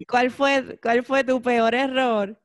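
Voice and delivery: laughing. Real, not acted. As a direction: recover and ask again, still laughing. Repeats question after choking, laughing. (preguntando entre risas, más fuerte)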